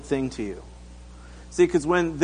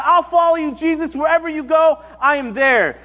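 high, loud, eager call; yelling from crowd; 10 semitones higher, 5 dB louder than usual. Acted. Man in crowd calling to Jesus, eager yell. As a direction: call out the pledge with raised pitch and volume. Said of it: "this guy yells out in the crowd".

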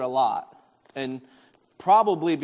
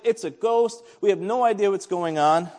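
raised, louder retelling of a panic; mock freak-out; 4 semitones higher, 5 dB louder than usual. Disciples spotting a figure across the waves, mock freak-out. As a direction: imitate people freaking out, pitched up. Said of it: "they're freaking out".

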